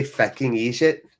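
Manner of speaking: tentative Irish "fecking eejit"; Irish accent, questioning; attempted Irish. Shown as a demonstration. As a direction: check an Irish-accented insult, rising at the end. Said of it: "Is that what I say?".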